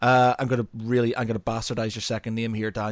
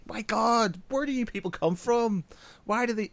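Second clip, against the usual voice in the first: high, exasperated disbelief; mock disbelief; 10 semitones higher than usual. Office worker mocking his bosses, high and incredulous. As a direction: throw up your hands in mock despair.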